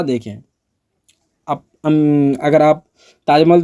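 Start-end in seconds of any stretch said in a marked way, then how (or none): none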